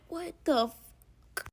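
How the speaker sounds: slow, soft drawn-out "What the fuck?"; stunned disbelief. Retold or imitated. Watching him stuff pills in diaper, slow stunned disbelief. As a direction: let it out slowly, stunned. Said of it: "I'm like".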